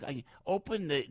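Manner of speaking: normal talking voice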